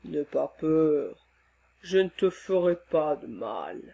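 calm him like a kind giant; gentle monster voice. Acted. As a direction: monster soothing the frightened man. Monster reassuring the man.

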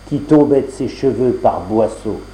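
plain reading voice

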